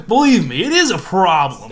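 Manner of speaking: "it is a problem" punched out loud; emphatic insistence. Real, not acted. Man explaining puberty to women, "it is a problem" said loudly and emphatically. Insisting after being questioned.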